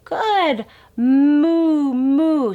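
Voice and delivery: high, loud cheering moo; delighted parent answering the baby; excited praise. Shown as a demonstration. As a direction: celebrate the baby's moo. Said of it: "they can do it as well".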